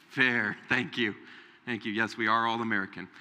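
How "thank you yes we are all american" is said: normal talking voice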